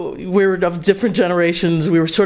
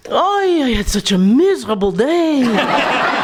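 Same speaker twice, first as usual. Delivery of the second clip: much higher, slow, hammed-up kvetching moan; mock moaning wife; 7 semitones higher, slower than usual. Wife moaning on cue, a comic kvetch. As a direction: groan the punchline like a weary wife.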